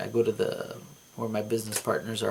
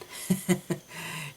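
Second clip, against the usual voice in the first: breathy laugh; 7 semitones higher, 6 dB quieter than usual. Real, not acted. Waking from the dream.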